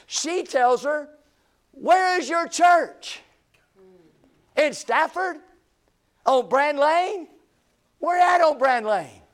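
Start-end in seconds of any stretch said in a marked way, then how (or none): 1.7-9.2 higher, slow nosy neighbor questioning; imitating a nitpicking neighbor quizzing a church member; mock neighbor Alice. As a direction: drag out each question like a suspicious neighbor. Preacher voicing neighbor Alice.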